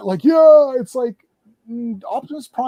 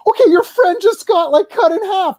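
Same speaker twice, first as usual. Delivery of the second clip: incredulous retort; 6 semitones higher than usual. Retold or imitated.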